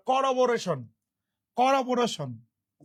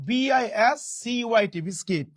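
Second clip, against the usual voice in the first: ordinary delivery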